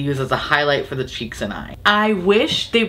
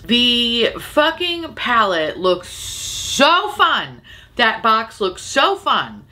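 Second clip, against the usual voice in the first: drawn-out gush; 5 semitones higher, much slower than usual. Real, not acted.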